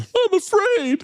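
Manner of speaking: very high, drawn-out whine; mock fear. Daniel facing sudden death, hammed-up frightened whine. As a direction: wail it like a hammed-up scared kid.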